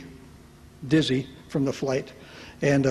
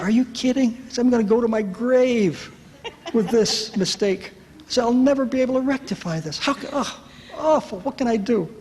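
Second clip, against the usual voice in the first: mock heartbroken lament over a mistake; anguished lament; 8 semitones higher than usual. Retold despair to wife.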